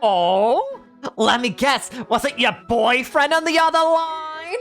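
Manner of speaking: very high, loud, slow teasing woman; mischievous teasing. Acted. Stylist teasing Yancy about the call, mischievous grinning voice. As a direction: tease her about the boyfriend. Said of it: "grinned mischievously".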